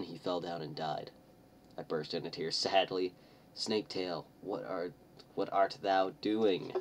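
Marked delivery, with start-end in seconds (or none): none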